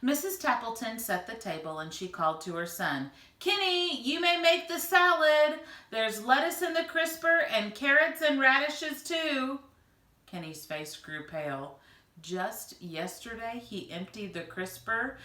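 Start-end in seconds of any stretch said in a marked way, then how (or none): 3.4-9.6 high, loud call across the house. Acted. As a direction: call to the son in the next room. Mother calls to her son.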